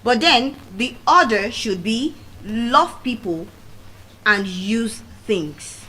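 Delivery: slow, stretched and use things; slow emphasis. Shown as a demonstration. Speaker correcting the saying, slow and deliberate. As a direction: spell the right order out slowly. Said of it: "slow corrected maxim".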